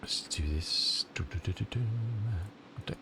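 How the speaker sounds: breathy whisper, slowed down; a quiet under-the-breath pep talk; whispered to self. Real, not acted. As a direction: whisper it to yourself while concentrating. Muttering to himself at the computer.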